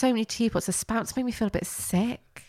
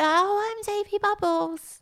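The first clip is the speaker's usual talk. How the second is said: much higher squeaky voice; co-host impersonating an annoying puppet, much higher; Soapy Bubbles voice; 13 semitones higher, slower than usual. Retold or imitated. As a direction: pitch way up like a cartoon soap. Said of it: "he's just a bit like".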